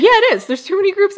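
high, loud contradiction; exasperated protest. Real, not acted. Reader insisting the groups are complicated, exasperated. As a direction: push back in high-pitched exasperation.

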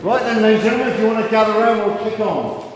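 projecting to gather a crowd; calling out. Real, not acted. Auctioneer calling the crowd together.

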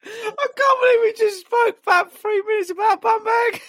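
words squeezed out through laughter; can barely speak for laughing. Real, not acted. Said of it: "he hasn't stopped laughing".

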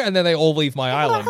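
ordinary talk

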